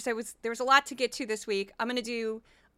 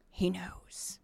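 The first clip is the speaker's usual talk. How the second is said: whisper; 4 semitones lower, 7 dB quieter than usual. Retold or imitated. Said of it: "and a brief Kari Lake".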